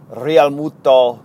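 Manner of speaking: Real Muto said slowly with a rolled Spanish R. Shown as a demonstration. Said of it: "I rolled the R's there".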